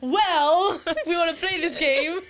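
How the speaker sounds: high, loud, laughing comeback; laughing retort. Retold or imitated. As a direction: toss it back through laughter. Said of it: "And I'm like".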